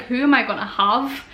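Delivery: normal talking voice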